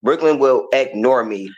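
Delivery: ordinary talk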